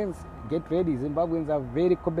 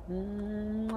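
kiss sound; 6 semitones higher, 7 dB quieter than usual. Pretend. Blows a kiss to Sheila.

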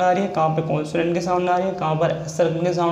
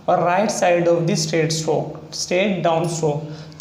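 slow; much slower than usual. Shown as a demonstration. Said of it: "teacher stresses rule slowly".

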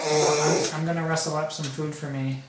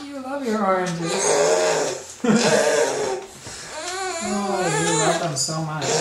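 excited cooing; 2 semitones higher, 7 dB louder, slower than usual. Real, not acted. Dad to baby at lunch, loud delighted cooing.

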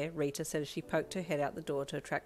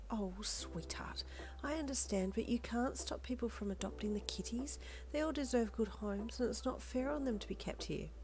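soft, higher, soothing voice; gentle reassurance; 6 semitones higher, 6 dB quieter than usual. A narrator's reading. Lolita explains adoption to the upset child, gently. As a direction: reason kindly, half-whispered at first.

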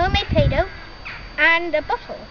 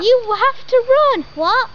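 urgent shout; 7 semitones higher, 5 dB louder than usual. Acted. Urging John to flee.

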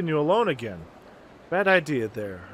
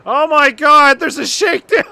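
higher and much louder exclamation; excited cry; 8 semitones higher, 10 dB louder than usual. Real, not acted. Player reacting to a sudden shakedown, excited loud cry. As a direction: burst out at the surprise.

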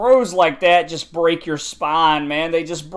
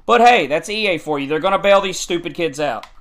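ordinary talk, nothing marked

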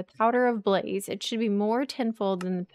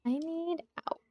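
whisper; 6 semitones higher, 5 dB quieter than usual. Real, not acted. Trapped in the game.

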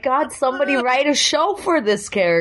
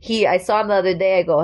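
ordinary delivery

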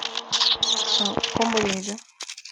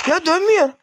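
exasperated yell; 6 semitones higher, 5 dB louder than usual. Real, not acted. Oyun donuyor, isyan ediyor.

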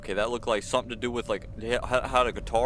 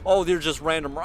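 ordinary talk, nothing marked

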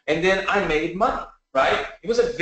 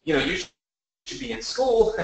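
imitating a panicked father, pitched well higher; mock alarm, jumping higher on each question; freaked-out dad; 7 semitones higher than usual. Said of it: "he was freaking out".

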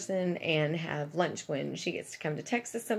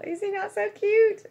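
high, louder gushing squeal; excited squeal; 8 dB louder than usual. Real, not acted. Showing viewers a plush gift, high gushing squeal. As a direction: coo over the plush toy.

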